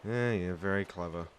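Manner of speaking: normal talking voice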